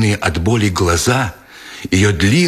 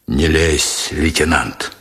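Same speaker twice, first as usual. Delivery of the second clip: gruff sergeant; 7 semitones lower, slower than usual. A narrator's reading. Sergeant warning the lieutenant to keep out, low and gruff.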